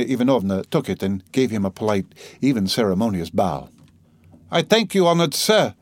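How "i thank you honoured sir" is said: higher-pitched, grand address to a gentleman; haughty gentlewoman giving formal thanks; lofty. A narrator's reading. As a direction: speak with lofty, ceremonious pride. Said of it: "she began loftily".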